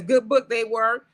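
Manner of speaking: ordinary delivery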